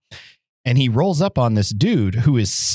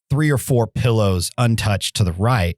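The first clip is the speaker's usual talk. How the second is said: ordinary delivery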